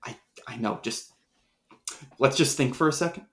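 very soft, rushed panic; hushed. Acted. Panicked hushed talk in crowd.

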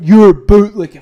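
loud sneering shout of the insult; mocked shout of an insult; mocking yell. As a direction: yell it like the people calling you names. Mock insult shouted back.

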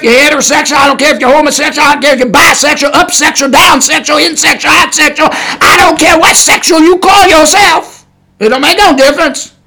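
fast, rising preacher's list; fiery preaching. Acted. Preacher listing sexual labels to his podcast audience, rising to a shout.

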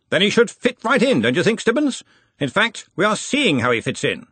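put-on a hearty old wizard in charge; Ridcully's voice.

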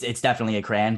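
crayon said as "cran"; one-syllable crayon. Shown as a demonstration. Defending his pronunciation, one-syllable crayon "cran". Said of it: "spelled in a way that would".

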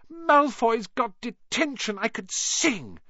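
put-on high, slow, delighted girl; gleeful. A narrator's reading. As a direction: savour the news. Hermione jigging.

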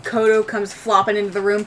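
ordinary talk, nothing marked

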